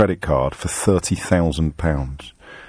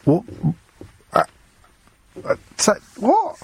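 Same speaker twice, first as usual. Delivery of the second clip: baffled; 11 semitones higher than usual. Real, not acted. Radio host lost by a caller's shower question, baffled. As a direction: stumble haltingly into a baffled, rising question.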